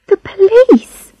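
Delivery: high-pitched, voiced stage-whisper question; alarmed girl. A narrator's reading. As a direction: ask it high and girlish, taken aback. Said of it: "she whispered".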